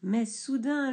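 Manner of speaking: plain reading voice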